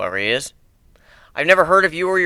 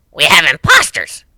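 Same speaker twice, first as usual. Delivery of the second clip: a louder scratchy dog voice; 6 dB louder than usual. Acted. The dog suggesting there are imposters, scratchy. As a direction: rasp it like a gruff dog. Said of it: "the dog with his scratchy voice".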